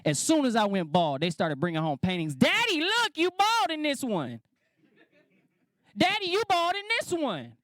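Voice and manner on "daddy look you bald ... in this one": put-on very high little-girl voice, drawn out; young daughters. Daughters showing bald dad their paintings, gleeful high child voice. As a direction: imitate a delighted child teasing Daddy. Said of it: "my two young daughters was gonna get a kick out of this one".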